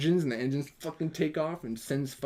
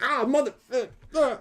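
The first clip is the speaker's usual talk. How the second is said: high, cut-off curse at the cat; mock pained yelp; 11 semitones higher, 4 dB louder than usual. Nick Fury scratched by Goose, a high mock yelp. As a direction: swallow the swear mid-word.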